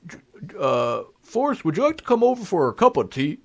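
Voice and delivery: stammer, then lift the voice into a courteous question; Barack Obama. Acted. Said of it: "and he says".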